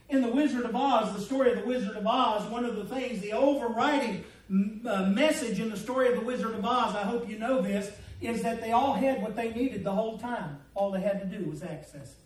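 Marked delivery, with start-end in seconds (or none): none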